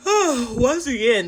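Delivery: slow yawn through the words. Real, not acted. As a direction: talk mid-yawn, stretched and breathy. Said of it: "there's that yawning".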